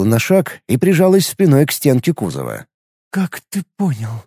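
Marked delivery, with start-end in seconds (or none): 3.1-4.2 soft, hushed question; quiet, whispered disbelief. A narrator's reading. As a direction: ask it in a stunned whisper. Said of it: "шёпотом спросила Лига".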